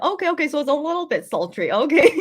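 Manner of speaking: ordinary delivery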